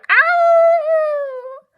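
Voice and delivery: high loud yelping howl; wolf fleeing in terror; terrified howl. A narrator's reading. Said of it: "with a terrified howl".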